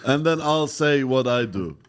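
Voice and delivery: halting foreigner's broken English, played for laughs. Comic arguing about law in broken English. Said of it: "when I break my English".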